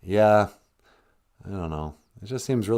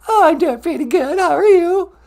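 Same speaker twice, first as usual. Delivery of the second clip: put-on very high, loud little-girl voice; squeaky girl caller; 14 dB louder than usual. Caller [name] greeting [name] in a very high girlish voice. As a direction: chirp a hello like an excited kid.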